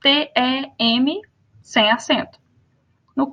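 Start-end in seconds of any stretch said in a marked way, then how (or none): 0.0-1.2 T E M spelled letter by letter, slowly. Shown as a demonstration. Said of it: "Esse tem". (soletrado devagar, letra por letra)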